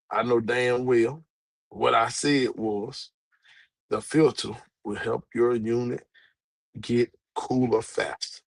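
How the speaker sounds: slow, deliberate insistence; emphatic restating. Real, not acted. Speaker correcting critics on the filter claim, slow and firm. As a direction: spell it out word by word, unhurried. Insists on exactly what was said.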